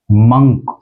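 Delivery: मंक as "munk"; मंक rhyming with "sunk"; correct pronunciation. Shown as a demonstration. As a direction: say मंक with a short "uh". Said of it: "इसको बोला जाएगा".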